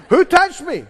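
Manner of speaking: a preacher voicing Jesus asking; searching Jesus. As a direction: call out over the crowd.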